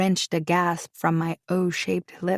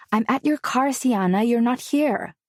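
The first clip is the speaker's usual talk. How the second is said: higher, louder, anxious cousin on the phone; worried cousin; 6 semitones higher, 3 dB louder than usual. Acted. Cousin calling from her car, worried and higher. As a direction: sound urgent, searching for her. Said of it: "his tone filled with worry".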